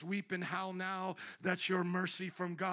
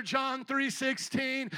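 usual speaking voice

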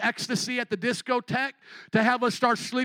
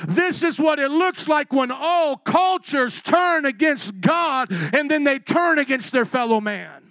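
building sermon; 4 semitones higher, 5 dB louder than usual. Acted. Preaching at full force.

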